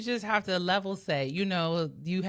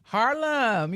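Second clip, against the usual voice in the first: amused echo; 6 semitones higher, 5 dB louder than usual. Real, not acted. Echoing the geese joke.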